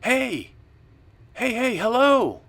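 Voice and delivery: high, loud, drawn-out holler; yell. Acted. Buddy yells to strangers down at the river, hollering. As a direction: holler across to people far away. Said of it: "yell to them".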